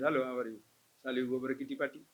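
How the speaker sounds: anger dropped, chatting sweetly with friends; friendly chat. Shown as a demonstration. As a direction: switch at once to light small talk. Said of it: "Immediately change".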